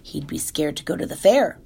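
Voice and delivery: plain reading voice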